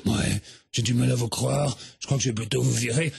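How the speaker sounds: low, gruff bouncer voice; stern guard. Acted. Guard refuses the fans at the hotel door, low and stern. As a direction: block them like a stone-faced guard.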